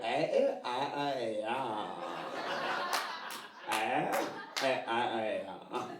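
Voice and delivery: loose drawn-out eh and ah sounds, mouth hanging open; mock the LA women's gaping vowel sounds. Comic mimicking LA women, open-mouthed vowels. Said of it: "it's just vowels".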